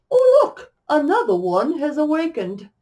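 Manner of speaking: put-on high, loud, chiming British accent. A narrator's reading. Unseen figure greeting the newly awakened dreamer, melodic British accent. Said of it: "low female voice in what sounded like a British, British accent chime".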